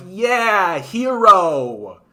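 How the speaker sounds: high, loud hammed-up cheer; mock cheering. Viewer cheering fantasy rioting, loud mock cheer. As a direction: cheer on the hero like a fan. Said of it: "and be like".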